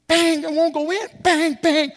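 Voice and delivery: high, loud, frantic ramming noises; mock frantic banging. Preacher acting out the woman ramming the pump, high and loud. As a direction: play the woman jamming the nozzle in, frantically.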